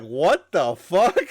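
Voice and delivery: loud, slow retold outburst; shocked outburst. Host retelling his girlfriend's reaction, loud slow outburst. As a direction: stretch it out in mock shock. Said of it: "she was like".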